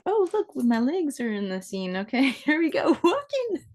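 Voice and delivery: high, bright-eyed giggly excitement; delighted surprise. Real, not acted. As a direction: squeal at seeing yourself on screen. Delighted at her legs on screen.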